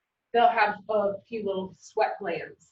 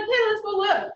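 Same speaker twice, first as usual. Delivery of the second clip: wheedling; 10 semitones higher than usual. Retold or imitated. Coaxing husband again.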